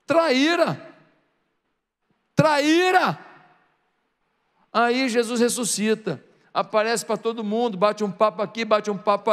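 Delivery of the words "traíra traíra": high, punchy name-calling; mock accusing shout. Preacher branding Peter a traitor, accusing call.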